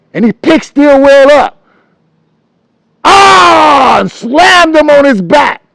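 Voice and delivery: slow and big, throwing in a shouted "ah"; hyped-up storytelling. Real, not acted. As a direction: build up the slam with a shout.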